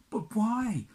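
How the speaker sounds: high, loud cry of disbelief; anguished cry. Acted. Prince pleading with hidden sisters, high loud cry. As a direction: cry out why. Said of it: "said the prince".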